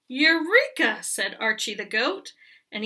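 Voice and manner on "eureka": triumphant eureka. Acted. Goat triumphs.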